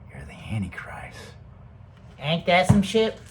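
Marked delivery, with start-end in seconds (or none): 0.1-1.2 low, hushed whisper; much softer, lower, whispered verdict; ominous whisper. Real, not acted. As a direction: drop to a dark whisper. Ominous punchline after soft build.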